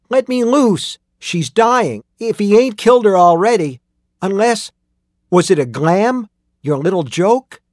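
put-on high, loud pleading boy; desperate Tim. A narrator's reading. Voices desperate young Tim.

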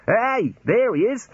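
high, drawn-out call; excited high-pitched shout. A narrator's reading. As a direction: call out on spotting someone, stretched and high. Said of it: "One of them shouted".

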